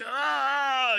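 a high, short mock wail of dismay; mock anguished cry. Jealous husband sees his wife on TV, mock anguished cry. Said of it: "my character on the bus going".

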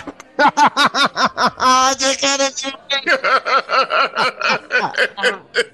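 long hearty laugh; big laugh. Real, not acted. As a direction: burst out laughing. After the joke punchline.

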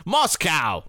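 "Moscow!" said the American way, MOSS-cow, wrestling-announcer style; American accent. Shown as a demonstration. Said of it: "said Moscow like an American".

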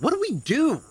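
high, frantic plea; exasperated outburst. Acted. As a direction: burst out in helpless frustration. Frustrated after everything failed.

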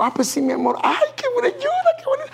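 high, laughing, falsely sweet reply; mock distracted sweet-talk. Answering a weeping person while distracted by the phone, hammed-up fake sympathy. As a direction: toss off a sing-song fake sympathy.

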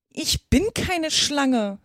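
a touch of wounded indignation; indignant. A narrator's reading. Alice denying to the pigeon she's a snake, indignant. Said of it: "mit Entrüstung".

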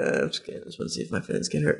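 hushed, quick half-joke to himself; a mock-hurt whisper under his breath; joking whisper. Real, not acted. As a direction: whisper it as a sheepish aside. Joking aside after a teasing review.

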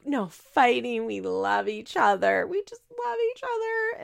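hammed-up lovestruck gushing; mock blissful Janelle. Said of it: "She's like".

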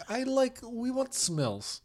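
put-on high, slow Ukrainian accent; Natalie. Bride in a boutique, high slow Ukrainian accent. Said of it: "She talks like this".

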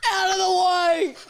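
loud barking drill sergeant; military sergeant. Retold or imitated. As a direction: shove people aside like a sergeant. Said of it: "military sergeant".

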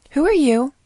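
loud, high, suspicious snap; sharp snap. Acted. Nurse snapping at the stranger beside the doctor, loud and suspicious. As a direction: demand it of the stranger. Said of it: "the nurse snapped towards Blank".

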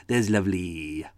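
slow, drawn-out mock Welsh; caricatured Welsh catchphrase; crappy Welsh accent. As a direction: stretch it into a fake Welsh lilt. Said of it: "crappy Welsh accents that don't exist".

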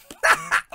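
short, high, loud burst of laughter; quick real yelp of laughter; sharp laugh. Real, not acted. Host laughing after teasing a viewer, sharp laugh.

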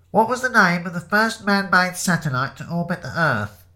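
high quizmaster reading out a question; pub quizmaster. Acted. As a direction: put it to the whole pub. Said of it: "said the quizmaster".

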